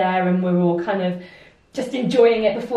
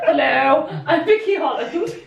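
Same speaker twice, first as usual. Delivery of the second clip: mock self-introduction; 5 semitones higher than usual. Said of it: "walk around like".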